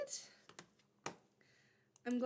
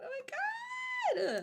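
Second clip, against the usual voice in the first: very high, slow, shrieking squeal; real starstruck shriek; fangirl squeal; much slower than usual. Real, not acted. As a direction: squeal like you just got a call from your idol. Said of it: "Kind of fangirling right now".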